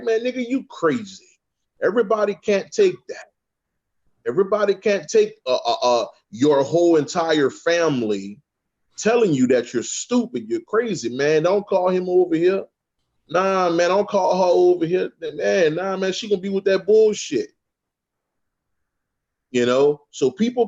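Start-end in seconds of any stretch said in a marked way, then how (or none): 0.0-1.3 raised-pitch scoffing family member; higher, dismissive relative scoffing; mocking relative. Family mocking his spiritual talk. 11.4-17.5 higher, warning don't-call-her voice; higher scoffing family member; dismissive relative. Retold or imitated. Family voiced avoiding him.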